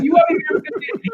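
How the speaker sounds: very high, loud incredulous squeak; aghast disbelief. Retold or imitated. Novice balks at fifty minutes, aghast and squeaky. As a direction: shoot up into a comic falsetto protest.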